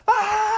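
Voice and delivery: high imitated yelp of pain; imitated scream. Retelling a prison shanking scene, imitated scream. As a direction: cry out sharply, far above normal pitch. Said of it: "and he's like".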